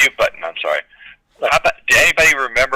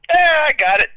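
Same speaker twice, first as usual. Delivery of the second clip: smug pilot; 4 dB louder than usual. Retold or imitated. Pilot's smug retort in commercial.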